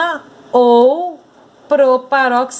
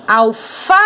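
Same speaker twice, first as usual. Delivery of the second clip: "Alface" called out with a long held "fa"; calling the word; 4 dB louder than usual. Shown as a demonstration. Teacher calling "Alface" to find its stress, "fa" drawn out. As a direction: call "Alface" like a name, stretching the middle syllable. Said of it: "nós vamos precisar chamá-las".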